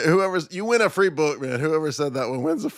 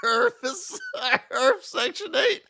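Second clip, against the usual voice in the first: laughing; slower than usual. Real, not acted. Laughing at own joke.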